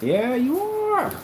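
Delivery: slow, drawn-out high rise, yeah you are; sassy retort. Real, not acted. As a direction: stretch it out mockingly. Retorting to denying people.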